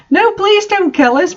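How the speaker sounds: high desperate plea; pleading. A narrator's reading. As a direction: beg for your life. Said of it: "pleaded Vampire".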